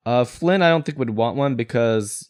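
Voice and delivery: normal talking voice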